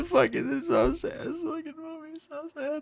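high, wailing whine, falling away soft; mock bawling. Stepping out of the bathroom, giving in to tears, high wail. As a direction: let it burst out like a tearful wail. Quoting himself breaking down.

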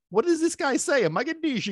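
high, confused listener asking; puzzled new listener. Retold or imitated. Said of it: "you're saying".

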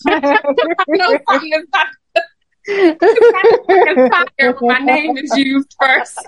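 giggling through the words; amused, breaking into laughter; laughing while talking. Real, not acted. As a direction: laugh it out as you talk.

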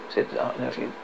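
ordinary delivery

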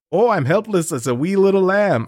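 put-on a self-conscious stab at an Irish accent; attempted Irish accent. IRA member pleading helplessly in a mock Irish accent. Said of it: "I can't do an Irish accent".

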